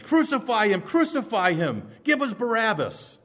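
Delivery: high, loud mob cry; mob shouting. Retold or imitated. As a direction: scream it like the riled-up crowd. Mob voiced screaming for Barabbas.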